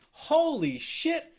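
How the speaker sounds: drawn-out, slow disbelief; slow, stunned holy shit. Real, not acted. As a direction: let it sink in slowly. Reacting to the clip.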